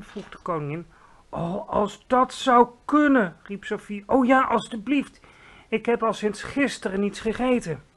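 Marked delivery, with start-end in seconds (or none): 1.3-7.8 put-on eager little girl. Sophie begs the Queen for breakfast, eager. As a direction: plead sweetly like a hungry child.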